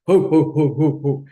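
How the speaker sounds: loud, rounded hoo-hoo laugh; low Santa laugh. Shown as a demonstration. Teacher asking class to try a low one, Santa laugh. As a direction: boom it out in even beats like Santa. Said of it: "Try that low one, like Santa".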